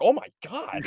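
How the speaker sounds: high, loud horrified gasp of words; mock shock. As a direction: imitate the mom's alarm. Mom reacts to Chucky snapping.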